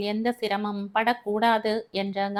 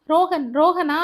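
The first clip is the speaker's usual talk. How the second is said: high, loud repeated name; can't believe it's him; shocked disbelief; 7 semitones higher, 6 dB louder than usual. Acted. Elder brother repeating the dead friend's name, shocked and loud. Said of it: "அதிர்ந்த".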